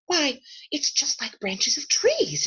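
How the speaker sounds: slow, wondering exclamation with rising pitch; exclaiming. Acted. Lucy finds branches in the wardrobe, exclaiming slowly in wonder. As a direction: marvel at it, drawing it out. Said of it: "exclaimed Lucy".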